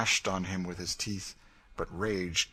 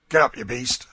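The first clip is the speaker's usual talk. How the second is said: loud, higher barked order; harsh command; 4 semitones higher, 11 dB louder than usual. A narrator's reading. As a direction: snarl it at the man on the ground. Legree kicking Tom.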